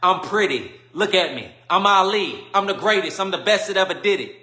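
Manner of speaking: loud, high boasting of "I'm the greatest"; Muhammad Ali boast. Retold or imitated. Imitating Ali boasting loudly to the crowd. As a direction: brag like the champ, full volume. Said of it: "what Muhammad Ali always said".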